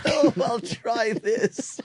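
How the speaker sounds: very high, drawn-out silly voice; mocking mimicry. Host parrots the reviewer's Bond, high slow mimicry. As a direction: stretch it out high, teasing the impression.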